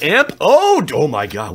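loud, sudden gasp of alarm; startled. Real, not acted. Reacting to a card just pulled, startled and loud. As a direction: blurt it out as if jolted. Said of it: "it scared the crap out of me".

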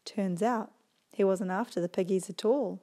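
usual speaking voice

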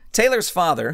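ordinary delivery, nothing marked

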